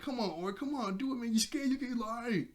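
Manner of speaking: high-pitched group egging-on; pressuring. Retold or imitated. Friends all pressuring Oric to climb, goading. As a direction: goad him in a high, pushy voice. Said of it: "William all pressuring".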